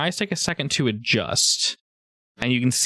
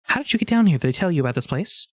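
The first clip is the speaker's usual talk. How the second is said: higher-pitched questioning nereid; water nymph; 6 semitones higher, faster than usual. Acted. Nereid questioning an intruder in her cave, water nymph voice. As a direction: question the stranger as a water creature. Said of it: "Leka, she's like".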